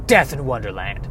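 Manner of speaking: low mock trailer announcer; movie trailer voice. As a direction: announce the action film title low, in parody. Mock eighties action film trailer.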